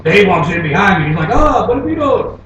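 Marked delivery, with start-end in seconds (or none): 1.3-2.4 high, drawn-out mock dismay; dismayed clerk. Clerk sees friend walk in, drawn-out mock dismay. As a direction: voice an appalled motel clerk, slow and high. Motel clerk reacts to Dave, acted.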